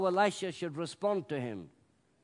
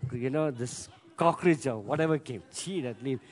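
grumbling Naaman; 7 semitones lower than usual. Pretend. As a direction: mutter about the dirty water in disgust. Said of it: "he got up and says".

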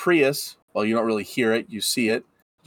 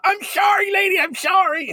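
much higher shouted sorry; panicked apology. Acted. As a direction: yelp out the apology. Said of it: "Haggis pops out".

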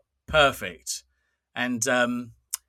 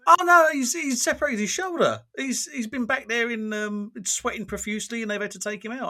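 alarmed retelling; 7 semitones higher than usual. Retold or imitated. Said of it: "I went".

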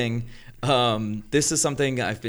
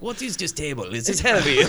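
hammed-up Russian mover, pitched up; bad Russian accent; 3 semitones higher than usual. Mover complaining about the table, bad Russian accent. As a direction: imitate a Russian mover, badly. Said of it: "I'm doing the worst accent ever".

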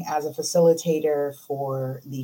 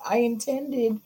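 defensive excuse; 6 semitones higher than usual. Pretend. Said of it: "You can intend a lot".

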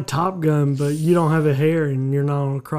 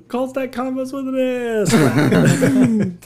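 mock plug; 6 semitones higher, much slower than usual. Podcast plug shouted while falling.

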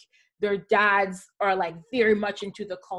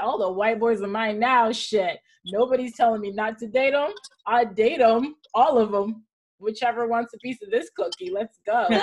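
hammed-up boast; 3 semitones higher, 4 dB louder than usual. Boastful retold inner voice.

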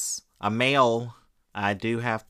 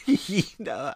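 laughing; 7 semitones higher than usual. Real, not acted. Laughs at own joke.

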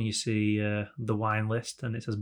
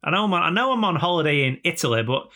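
higher-pitched mock indignation; arrogant English tourist; 5 semitones higher than usual. English holidaymaker to a local in Italy, mock-indignant. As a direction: lecture a foreigner with haughty disbelief. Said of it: "that is the English attitude".

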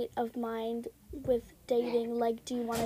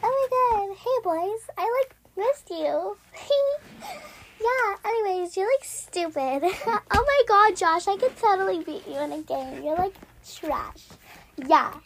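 hammed-up pick-me girl teasing; 4 semitones higher than usual. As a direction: tease the boys in a mock girly voice. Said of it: "best impressions of Pick Me Girls".

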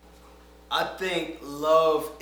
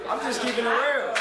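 loud; 10 semitones higher, 9 dB louder than usual. Real, not acted. Blurts loudly, told keep down.

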